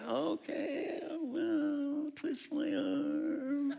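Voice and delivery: high, drawn-out feigned giving in; mock reluctance. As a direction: stretch it out high, as if coaxed. Mock reluctance before accepting the steak.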